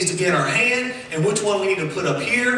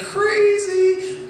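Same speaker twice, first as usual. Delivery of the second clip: excited exclamation; 14 semitones higher, 5 dB louder than usual. Real, not acted. Amazed at the Grand Canyon walk.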